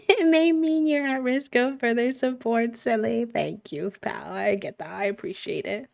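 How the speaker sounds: usual speaking voice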